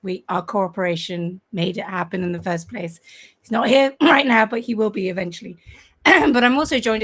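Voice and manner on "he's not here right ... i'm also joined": hoarse, throat-clearing croak; croaky voice. Real, not acted. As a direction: talk through a croaky throat. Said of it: "excuse my croaky voice".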